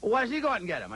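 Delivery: very high, mock-arguing wife voice; imitating wife. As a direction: push the quoted wife's question way up high. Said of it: "and she goes".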